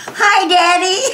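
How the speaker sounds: high, loud laughing call; shouted greeting. Retold or imitated. Girl calling down to her father from the plane, loud and gleeful. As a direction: holler down from the plane, delighted. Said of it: "I shouted out of the plane".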